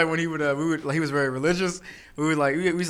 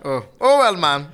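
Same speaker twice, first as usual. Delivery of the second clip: mock shrug; 2 semitones higher, 7 dB louder than usual. Mimicking unbothered dad.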